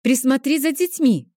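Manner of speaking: loud, high shouted favour; hurried yell for help; hollered request. A narrator's reading. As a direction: call out to your friend on the run. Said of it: "заголосила я".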